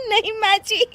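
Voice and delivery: high, stumbling giggly protest; squeaky denial through giggles; laughing excuse. Acted. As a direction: start an excuse and collapse into laughter. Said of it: "फिर से हंस रही हो".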